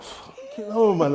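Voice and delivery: exasperated outburst. Retold or imitated. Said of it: "I'm like".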